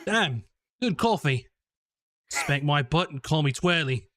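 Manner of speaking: put-on quick offhand Australian accent, lightly done. Showing off a spur-of-the-moment Australian accent to friends, casual Australian accent. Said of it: "what's my Australian off the cuff".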